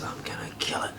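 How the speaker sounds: barely audible retold threat; menacing whisper. Girlfriend vows to kill the cat, menacing whisper.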